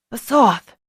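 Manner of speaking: sudden alarmed call of the dragon's name; startled exclaim. A narrator's reading. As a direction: cry out as the breath rattles. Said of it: "Jamarin exclaimed".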